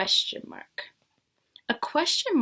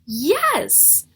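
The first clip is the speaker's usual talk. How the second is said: excited yes; 4 semitones higher, 8 dB louder than usual. Acted. The story's answer revealed.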